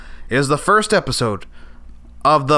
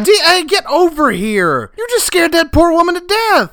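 exasperated scolding; 13 semitones higher, 4 dB louder than usual. Acted. Host scolding D A.

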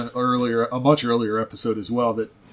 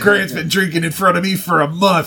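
mock complaining friend; 7 semitones higher, 4 dB louder than usual. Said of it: "I'll be like".

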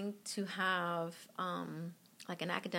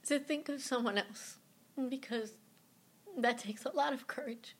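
higher, choked voice fighting tears; holding back tears; 6 semitones higher than usual. Real, not acted. Mother thanking the donor family, fighting back real tears. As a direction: push through as tears come. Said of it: "I'm gonna start crying now".

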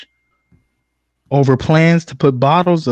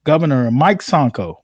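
ordinary talk, nothing marked

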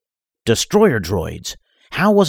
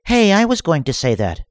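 a touch of petulance, higher voice; lightly upset villainess; 10 semitones higher than usual. Acted. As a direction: complain like a spoiled villain. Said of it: "Shivillo said, upset".

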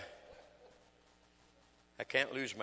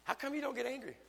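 wife's question; 3 dB louder than usual. Retold or imitated. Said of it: "my wife will say".

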